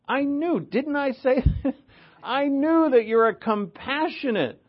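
high, loud, exasperated complaint; whiny Jonah. Retold or imitated. Preacher voicing Jonah griping at God, high and whiny. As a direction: whine it at God like a sulking prophet.

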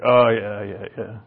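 a hint of an unimpressed old Irishman; gruff old Irishman. Old uncle answering about the view, lightly gruff Irish. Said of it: "Patty in his gruff old Irish".

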